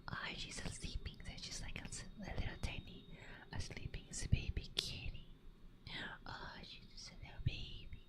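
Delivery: very soft hushed whisper. Real, not acted. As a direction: whisper so the cat sleeps. Cat falling asleep nearby.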